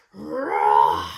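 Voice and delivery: low, loud growling roar; deep engine roar. A narrator's reading. Narrator makes the rocket roar, low and loud.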